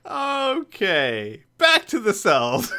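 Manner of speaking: high, laughing as the scene shifts. Real, not acted. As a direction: giggle through the line. GM laughing, moving on.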